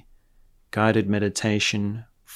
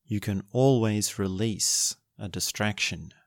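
guided meditation; 5 dB quieter than usual. Acted. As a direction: guide them gently into stillness.

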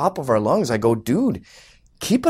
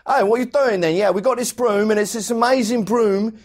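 higher-pitched British infomercial pitchman; British accent; 4 semitones higher than usual. Retold or imitated. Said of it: "English people, like people from England".

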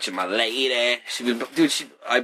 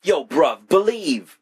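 mock London accent, pitched up; hammed-up London accent, high and bright; British slang; 6 semitones higher, 3 dB louder, much slower than usual. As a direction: toss off a jokey British street voice. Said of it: "They use words like bruv".

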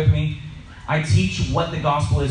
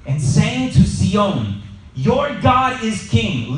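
scripture reading; 5 semitones higher, slower than usual. Acted. Reading Isaiah aloud.

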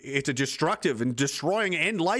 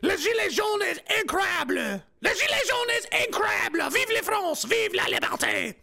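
mock French shouting. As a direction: shout like an excited French reporter in broken French. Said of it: "Something like that. I think that's what she was saying".

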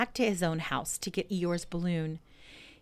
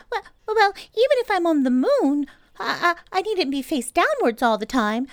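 high, loud, squeaky little pig; Piglet voice; 11 semitones higher, 6 dB louder than usual. Acted. Piglet after falling, high squeaky voice. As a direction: squeak it out like a tiny nervous pig.

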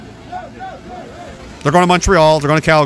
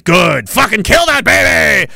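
mock cheering; 3 semitones higher, slower than usual. Mocking a cheering war supporter.

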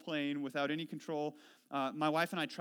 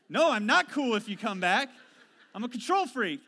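louder, higher, mock-outraged reply; mock indignant retort; 6 semitones higher, 10 dB louder than usual. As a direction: snap back at the passenger in jest. Imagined retort to reclining passenger.

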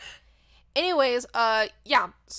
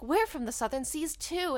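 put-on higher-pitched, weird not-quite-British accent; Tallulah's accent; 6 semitones higher than usual. Monkey greets a fellow islander, not-quite-British accent. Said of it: "That's Tallulah's accent".

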